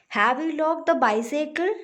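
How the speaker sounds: high question climbing to "bicycle"; rising question tone. Shown as a demonstration. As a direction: lift the voice at the end on "bicycle". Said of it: "it's rising tone".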